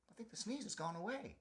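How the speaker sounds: hushed relief. Acted. Sneeze fades, hushed relief.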